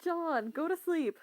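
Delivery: firm, drawn-out bedtime order; scolding. Real, not acted. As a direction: draw it out like ordering someone to bed. Friend ordering John to bed.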